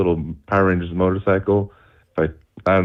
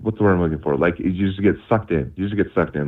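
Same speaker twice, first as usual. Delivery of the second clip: normal talking voice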